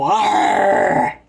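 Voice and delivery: loud scary roar, pouncing; growling roar. Retold or imitated. Boy springing out from under the covers at his sister, loud scary roar. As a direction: roar out to scare her.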